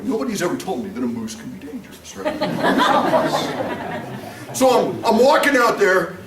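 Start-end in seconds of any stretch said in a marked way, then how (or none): 2.4-4.5 breathy burst of laughter; a real amused laugh. Real, not acted. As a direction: laugh at your own story. Laughs telling moose story.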